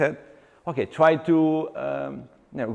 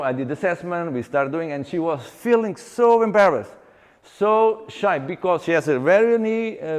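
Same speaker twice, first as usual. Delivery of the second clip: usual speaking voice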